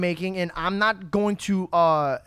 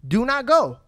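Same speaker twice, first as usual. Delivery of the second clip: loud, higher plea straight to camera; direct plea; 6 semitones higher, 8 dB louder, slower than usual. Real, not acted. Host speaking to viewers who feel it's their time, loud urgent plea. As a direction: beg the viewer loudly not to go. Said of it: "I will look at the camera".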